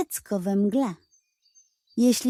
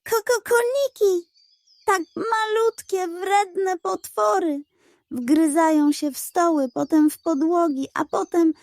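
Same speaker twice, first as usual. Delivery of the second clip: much higher, clucking, stammering hen; panicked hen; 7 semitones higher than usual. A narrator's reading. Hen panicking about woodworms, high and clucking. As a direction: cluck and stutter in terror.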